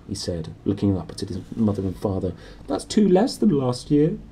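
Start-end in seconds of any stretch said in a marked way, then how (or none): 2.7-4.2 put-on high whiny spoilt boy; whiny Dudley. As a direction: sulk over the count. Dudley complains.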